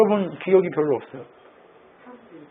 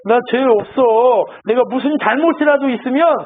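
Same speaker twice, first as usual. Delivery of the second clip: aggrieved Job, voice raised; wronged protest; 6 semitones higher, 4 dB louder than usual. Retold or imitated. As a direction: protest high and loud as the unjustly accused. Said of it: "억울하겠죠".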